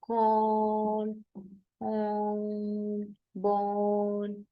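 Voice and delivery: कॉर्न and बॉर्न slowly, vowel held long and rounded. Shown as a demonstration. Teacher demonstrating the long vowel, कॉर्न and बॉर्न drawn out. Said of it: "long mid back rounded vowel".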